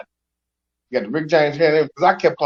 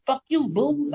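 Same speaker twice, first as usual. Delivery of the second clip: high, light playful curse; 10 semitones higher, 4 dB quieter, slower than usual. Retold or imitated. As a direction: tease it like a pet name.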